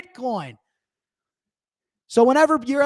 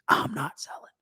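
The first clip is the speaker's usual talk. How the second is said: breathy whisper, lower; 6 semitones lower, 5 dB quieter than usual. Real, not acted. Host vowing to chat he won't sell, whispered. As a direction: hiss it under your breath with resolve. Host's quiet defiant aside.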